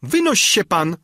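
loud, high-pitched order; angry cry. A narrator's reading. Albertyna orders the suitor out, crying out. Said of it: "zawołała głucho Albertyna".

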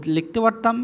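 normal talking voice

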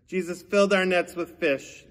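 usual speaking voice

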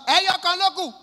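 high, loud vendor's call; imitated food seller calling out; street hawker's shout. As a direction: holler your wares out loud. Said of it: "She go shout".

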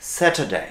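"স্যাটারডে" as sat-uh-day, tongue relaxed; simple soft R. Shown as a demonstration. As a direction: say "স্যাটারডে" easily, without rolling the R. ("স্যাটারডে" সহজে, র না কাঁপিয়ে)

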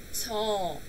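usual speaking voice

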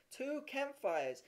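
high, slow, drawn-out exclamation; awed excitement. A narrator's reading. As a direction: marvel at it like a thrilled child. Narrator voices boy's amazement.

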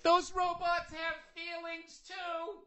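very high, slow, soft mock liberal; hammed-up pleading liberal; mocking a liberal pleading for robots; whiny liberal. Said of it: "the liberals at some point would be like".

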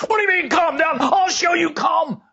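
high, loud indignant shout; angry outburst. Acted. Someone told to calm down, shouting back high and loud. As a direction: bristle and yell back at being told to calm down.

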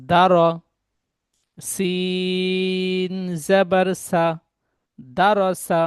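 slow, separated spelling then the whole word; teacher's deliberate spelling drill; spelled piece by piece. Shown as a demonstration. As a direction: cut each syllable apart before joining. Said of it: "আবার পড়ছি".

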